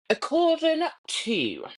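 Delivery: usual speaking voice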